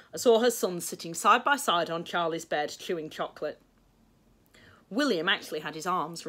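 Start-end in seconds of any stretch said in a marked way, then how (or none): none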